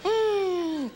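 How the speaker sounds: high pleased mm; a content little hum after a sip; satisfied hum. Retold or imitated. Old lady after a drink, high satisfied hum.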